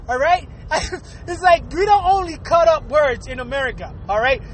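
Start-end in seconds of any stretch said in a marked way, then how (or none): none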